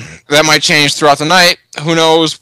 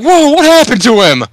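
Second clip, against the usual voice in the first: surprised; 12 semitones higher, 4 dB louder than usual. Retold or imitated. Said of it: "You guys are all just like".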